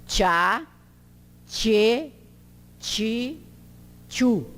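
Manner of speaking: "tchá, tchê, ti, tchu" separated, "ti" as chee; TCH syllable drill. Shown as a demonstration. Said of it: "ele tem esse som assim".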